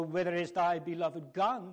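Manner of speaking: usual speaking voice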